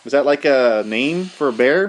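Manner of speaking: ordinary delivery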